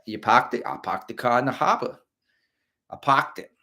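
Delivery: put-on a light Boston accent, dropped r's in parked, car and harbor. Runner imagining Boston locals talking, light Boston accent. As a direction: say parked as pahked and harbor as hahbah. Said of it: "That's what I want from Boston".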